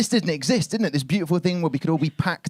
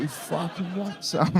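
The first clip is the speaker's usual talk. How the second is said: bag taunting; 3 semitones higher, 6 dB quieter, slower than usual. Retold or imitated. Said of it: "it was swaying a bit, like".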